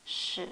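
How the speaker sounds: « shi » avec les lèvres avancées; « shi » arrondi, lèvres en avant; shi lèvres sorties. Shown as a demonstration. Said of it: "Sortez un petit peu les lèvres". (bonne prononciation chinoise)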